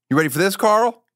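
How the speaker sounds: ordinary delivery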